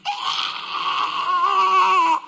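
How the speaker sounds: long high re-enacted wail; loud bawling wail. Husband floored by his wife's confession, wailing. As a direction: burst out bawling on one long cry. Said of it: "I went".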